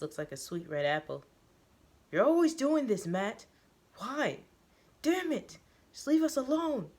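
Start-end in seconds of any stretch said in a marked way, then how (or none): 2.1-6.9 high, loud furious shouting; angry yell. Acted. Joseph yelling at Matt in the cafeteria, furious shout. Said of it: "He yells one last time, very loudly".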